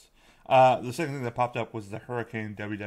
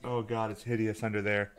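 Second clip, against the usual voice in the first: ordinary talk, nothing marked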